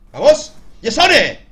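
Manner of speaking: shouting himself on; loud cheering. Real, not acted. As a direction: yell it out to pump yourself up.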